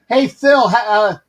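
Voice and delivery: New York Bronx voice; Bronx accent. Retold or imitated. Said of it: "'cause I'm trying to do the Bronx accent".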